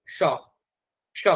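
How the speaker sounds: স as a middle sh sound between the two; soft sh for স, tongue at the palate; palatal s. Shown as a demonstration. Teacher demonstrating the palatal s to the class. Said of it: "আর যদি মাঝামাঝি রাখেন তাহলে তালব্য স".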